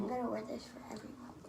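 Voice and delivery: soft fast whisper. Shown as a demonstration. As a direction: breathe it out in a whisper. Same line repeated in whisper.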